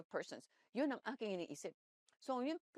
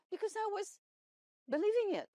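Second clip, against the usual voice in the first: excited; 10 semitones higher, 7 dB louder than usual. Real, not acted. Preacher excited about faith.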